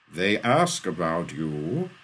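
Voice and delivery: slow, loud, stretched words; booming reply. A narrator's reading. Eden answers Shaw about the family, booming.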